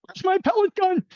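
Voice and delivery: very high, loud, hammed-up squawk; hammed-up high yell for his gun against the iguanas; mock hunting cry. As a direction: squeal it like a cartoon hunter.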